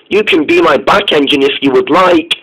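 ordinary delivery, nothing marked

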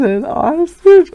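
laughing incredulous question; amused disbelief. Retold or imitated. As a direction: echo it in delighted disbelief. Echoing the friend's joke.

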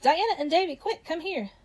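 urgent calling out. A narrator's reading. Urgent calling to friends in story.

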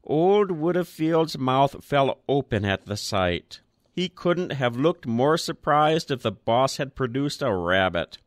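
ordinary delivery

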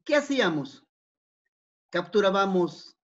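ordinary talk

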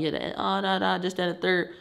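a light impression of the aunt arguing on the phone; mock aunt on the phone. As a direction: imitate her arguing on the phone. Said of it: "She arguing".

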